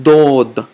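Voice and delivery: Dod slow and loud, from the throat; tongue on teeth, long rounded Dod; throaty heavy D. Shown as a demonstration. Teacher demonstrating letter Dod, heavy throaty D. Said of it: "throat sound and tongue and teeth".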